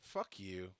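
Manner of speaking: soft, muttered inner curse; bitter resignation. Retold or imitated. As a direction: mutter it under your breath, fed up. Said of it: "At that point, I went".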